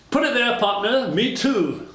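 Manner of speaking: slap him on the back with your voice; hearty Texan-style greeting. Retold or imitated. Retelling the mayor's delighted partner greeting, hearty and high.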